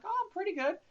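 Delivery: high, falsely innocent voice; overly innocent reply. Retold or imitated. Driver playing innocent to the police officer, high innocent voice. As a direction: act innocent to the cop, voice way up. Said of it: "I'm like".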